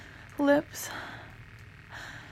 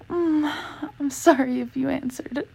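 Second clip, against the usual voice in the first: normal talking voice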